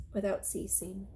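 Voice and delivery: usual speaking voice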